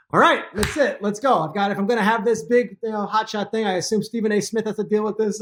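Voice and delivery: mock swagger, ready for the showdown; pumped-up bravado. Host psyching himself up to face the coach, cocky and loud. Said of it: "So I'm like".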